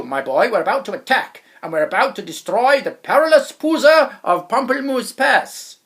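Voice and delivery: loud, blustering general's bellow. A narrator's reading. General commanding the boy to join the army, bellowing. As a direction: bark orders like a pompous general. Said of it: "He bellowed".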